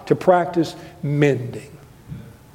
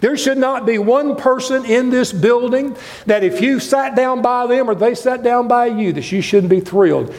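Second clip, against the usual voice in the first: usual speaking voice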